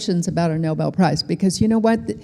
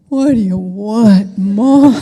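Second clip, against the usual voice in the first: slow, drawn-out groan rising louder on "Mom"; exasperated teenage son; 3 semitones higher, 8 dB louder, slower than usual. Retold or imitated. Older son answering mother's early morning phone call, exasperated.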